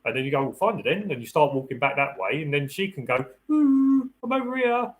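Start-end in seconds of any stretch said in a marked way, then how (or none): 0.4-1.1 encouraging dog command. Acted. Owner giving dog search cue. 3.5-4.9 very high, drawn-out "ooh" to lure a dog; sing-song calling out. Acted. Hidden partner calls to dog.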